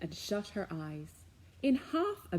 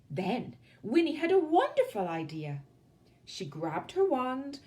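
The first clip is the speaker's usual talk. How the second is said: ordinary delivery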